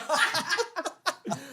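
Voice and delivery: high burst of laughter at his own joke. Real, not acted.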